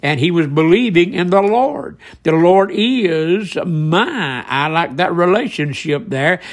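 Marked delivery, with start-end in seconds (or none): 2.2-4.4 very slow, long-held "is" and "my"; very emphatic. Retold or imitated. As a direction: draw out "is", then hang on "my". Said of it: "David said very emphatically here".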